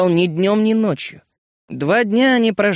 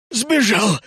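puffing; 5 semitones higher than usual. A narrator's reading. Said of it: "пропыхтел Рот".

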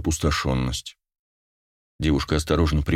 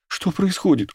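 high, bewildered cry; panicky, much higher voice; frightened; 13 semitones higher than usual. A narrator's reading. Girl backing toward the window, frightened bewilderment.

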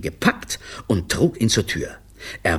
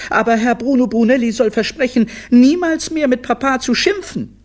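put-on high, loud kids' demand; high-pitched childish protest; demanding children; 5 dB louder than usual. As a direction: insist like defiant children. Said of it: "forderten sie".

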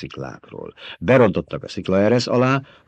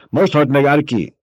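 high, louder shocked announcement; alarmed cry; 8 semitones higher, 5 dB louder than usual. A narrator's reading. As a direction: cry out the news of the death. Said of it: "harsant föl a segédtiszt hangja". (riadt, hangos kiáltás)

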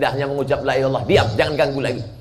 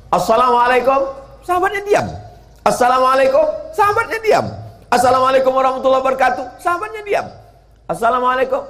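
calling at the door; 13 semitones higher, 3 dB louder, faster than usual. Acted. The Prophet greeting at the door.

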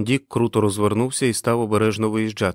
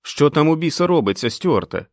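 higher, raised demanding question; mild shout; 6 semitones higher, 4 dB louder than usual. A narrator's reading. Al Bundell calls to Stuart for answers, shouting. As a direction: call out, wanting answers. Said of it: "Ел Бандел закричав".

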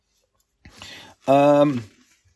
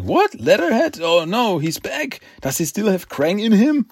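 much higher, panicked surprise; alarmed Mikey; 6 semitones higher than usual. Retold or imitated. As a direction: squeak out the shock of the villain's return. Mikey shocked Leatherhead is back.

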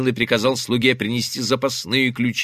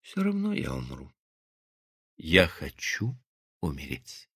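very faint, halting words; lifeless, weary; 7 semitones lower, 11 dB quieter than usual. A narrator's reading. Wounded wife tells her husband of wanting death, lifeless. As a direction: let it fade to almost nothing, exhausted. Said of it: "так же безжизненно и устало".

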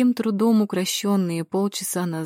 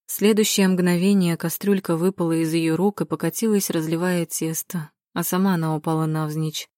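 ordinary delivery, nothing marked

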